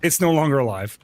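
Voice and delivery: normal talking voice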